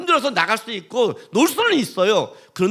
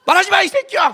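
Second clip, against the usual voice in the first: higher, louder mock drunk scolding; drunk quarrel; 8 semitones higher, 6 dB louder than usual. Drunk believer scolding friend mocking his God, mock angry. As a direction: play the tipsy believer telling his buddy off. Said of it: "술 먹고 싸우는 거예요".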